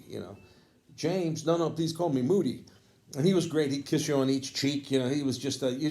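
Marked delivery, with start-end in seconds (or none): 1.0-2.7 a touch higher, friendly insistence on his nickname. Retold or imitated. Old jazz saxophonist gently corrects young fan, lightly voiced. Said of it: "[name]," he always wanted you to call him [name]".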